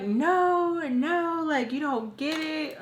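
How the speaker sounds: high, drawn-out mock pleading; retold pleading protest. Daughter protesting to her laughing mom, high mock plea. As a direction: imitate a distraught kid insisting.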